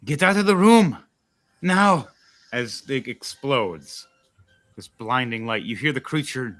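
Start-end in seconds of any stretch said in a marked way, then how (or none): 0.1-2.1 urgent yelled warning; shouting. Acted. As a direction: bark the order to clear out. Warning everyone before explosion.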